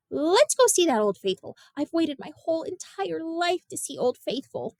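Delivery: pleading to finally see the geyser; eager Graham. Acted. Girl to Gramps, eager about seeing Old Faithful.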